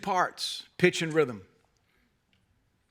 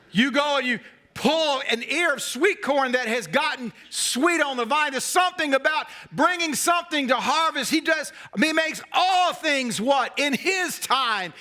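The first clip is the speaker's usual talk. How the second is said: preaching at full force; 8 semitones higher than usual. Acted. Preacher shouting to the congregation about harvest. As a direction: build to a shouted climax.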